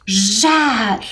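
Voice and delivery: "jar" drawn out as one syllable; isolated, lengthened "jar"; showing the sound. Shown as a demonstration. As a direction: hold the sound of "jar" for pupils to hear. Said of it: "olha o som".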